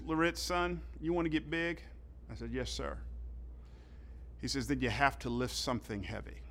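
quiet, earnest coach advice; soft coach advice. Retold or imitated. Coach gives freshman life advice, quietly. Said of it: "said to me something I'll never forget".

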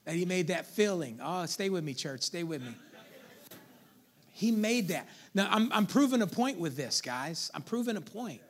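usual speaking voice

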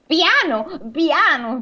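high-pitched storybook crow; crow protesting. Acted. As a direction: fend off a hug as the crow. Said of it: "disse la cornacchia".

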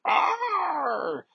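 very high, sudden cry of pain; sharp high scream of pain. Acted. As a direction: yelp as if struck in the stomach. Storm collapses holding her stomach.

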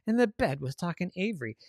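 ordinary delivery

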